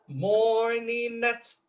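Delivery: "morning at" high and drawn out, first syllable strong; dactyl stress. Shown as a demonstration. Lecturer scanning the lyric, high slow "morning at" as a dactyl. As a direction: intone "morning at" slowly, strong-weak-weak. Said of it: "uma sílaba forte, seguida de duas".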